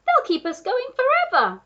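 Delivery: high, loud excited voice; monkey crying out. A narrator's reading. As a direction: cry it out high, thrilled at the job. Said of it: "cried the monkey".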